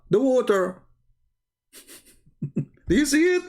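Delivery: high, laughing triumphant jeer; mocking laugh. Real, not acted. Gloating to viewers over the throne under the water, laughing high. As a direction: laugh at the punchline, voice jumping up.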